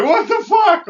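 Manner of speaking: high, loud shocked cry; loud and high in stunned anger; outraged disbelief. Retold or imitated. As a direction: shout it like you just got robbed. Retelling being robbed by cops.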